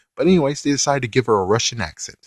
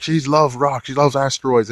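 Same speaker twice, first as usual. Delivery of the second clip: goofy comic character; 4 semitones higher, 4 dB louder than usual. Retold or imitated. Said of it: "where he's like".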